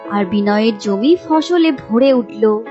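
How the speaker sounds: usual speaking voice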